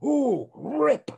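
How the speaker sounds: put-on high, gleeful child's squeal; excited grandson. Imitating his grandson tearing open the box, high and gleeful. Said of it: "grabs the box and goes".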